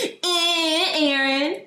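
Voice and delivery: high, laughing mock taunt; laughing schoolboy jeer; taunting boy. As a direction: tease like a snickering little boy. Imitating the boy who taunted her.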